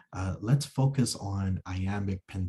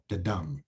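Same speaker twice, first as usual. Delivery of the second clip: the soft, dum hit hard; weak the then strong dum; da-DUM rhythm; stress on dum; 2 semitones lower, 5 dB quieter than usual. Shown as a demonstration.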